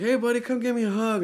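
put-on high-pitched call to a little boy; excited greeting. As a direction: greet your small son eagerly, pitched well up. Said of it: "was really excited to see him".

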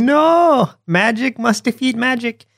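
high mock yell, cartoon uncle; hammed-up high cry with a long NO; Uncle impression. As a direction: pitch it way up, imitating an insistent cartoon uncle. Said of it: "And yelling it".

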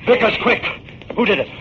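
high, urgent questions to a dying man; urgent pleading. Acted. Detective begging the stabbed pawnbroker for the killer, urgent. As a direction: press him for a name fast.

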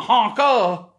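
honker ending in a loud open UH, no R; altered ending. Shown as a demonstration. Mocking the word swap to listeners, altered ending. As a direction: drop the R from honker, end on UH. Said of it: "take the Y off of honky".

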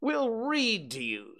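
high, chirpy reassurance; cheery, high-pitched offer; cheerful rabbit. A narrator's reading. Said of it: "said Rabbit cheerfully".

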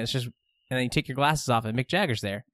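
normal talking voice

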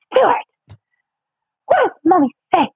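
high-pitched comic mother voice; high mock-mommy voice, broken by pauses; Stuart's mom. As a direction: prod a child in a high sing-song. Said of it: "Can you do Stuart's mom?".

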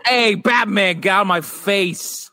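high, brash Gotham tough guy; Gotham accent. Pretend. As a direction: tell Batman off like a street tough. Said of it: "Gotham people are like".

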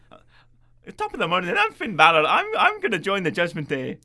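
a soft-spoken Irish lad, hammed-up Irish brogue. Mocking Finn joining the stable in an Irish accent. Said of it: "softly spoken little Irish lad".

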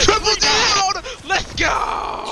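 high, slow shouted announcer call; Halo announcer voice. Acted. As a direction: bellow it like the game announcer. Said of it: "the Halo announcer voice each time we get a kill".

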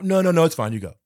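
mock refusal. Duchamp refusing.

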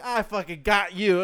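high, loud, drawn-out crowing with laughter; hammed-up gloating. Boss springing his prank on a clerk, loud gloating. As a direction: crow it like a triumphant prankster.

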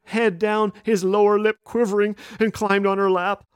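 ordinary talk, nothing marked

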